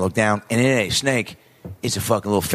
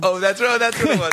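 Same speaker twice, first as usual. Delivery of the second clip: sudden realization; 10 semitones higher than usual. Real, not acted. Remembering the story's punchline, surprised. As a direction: voice jumps high, remembering the line.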